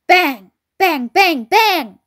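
loud bangs climbing in pitch; acted gunshot bangs. A narrator's reading. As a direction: fire each word like a shot, building. Farmer acts out the gunshots.

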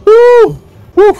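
short high "woo" whoops; startled high woo. Real, not acted. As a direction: let out two quick, high-pitched whoops. Reacting in the game.

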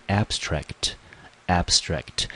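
abstract said slowly, AB stressed, twice; slow first-syllable stress. Shown as a demonstration. Teacher slowly modelling stress on AB for students. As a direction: drag it out and lean on AB. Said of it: "中音节落在第一个音节".

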